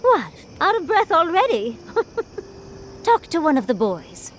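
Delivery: high, mock-surprised taunt breaking into a laugh; playful teasing. Trainer to a winded sparring partner, playful teasing.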